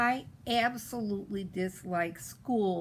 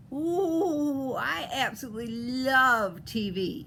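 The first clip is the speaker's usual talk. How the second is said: gushing delight; 3 semitones higher, 5 dB louder, slower than usual. A narrator's reading. Eloise adoring her television, loud gushing delight. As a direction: gush with stretched-out adoration.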